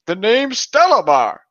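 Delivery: a wounded beast announcing its name; griffin voice. Acted. Griffin names himself.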